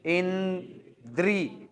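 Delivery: इंद्री drawn out very slowly, each sound apart; slow demo pronunciation. Shown as a demonstration. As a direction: stretch इंद्री out syllable by syllable. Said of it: "उच्चारण करो".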